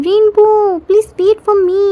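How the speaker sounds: high playful plea; eager pleading. A narrator's reading. Jimin begging the rainbow to stay, eager plea.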